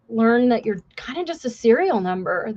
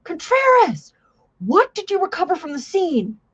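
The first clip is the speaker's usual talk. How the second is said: much higher, drill-instructor bark; yelling instructor; 7 semitones higher than usual. Retold or imitated. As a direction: call the recruit out like a drill instructor. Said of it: "the instructors yell at Contreras".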